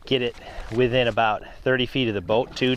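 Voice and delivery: ordinary talk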